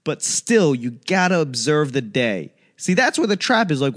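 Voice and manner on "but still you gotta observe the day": ordinary talk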